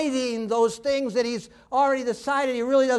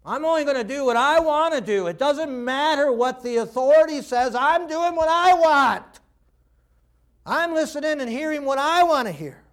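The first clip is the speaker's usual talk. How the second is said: higher, insistent voice of rebellion; defiant; 5 semitones higher, 4 dB louder than usual. Acted. Rebellion answering authority, defiant. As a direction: dig in stubbornly, building louder on the wants.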